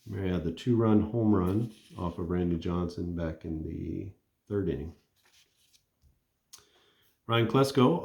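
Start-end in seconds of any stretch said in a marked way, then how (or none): none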